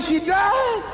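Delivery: high, stunned question; shocked. Retold or imitated. Told the contact died, he asks in stunned disbelief. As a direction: hear the news and can't believe it. Hearing of Evelyn's death.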